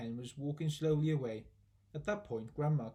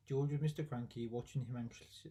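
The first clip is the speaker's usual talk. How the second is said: usual speaking voice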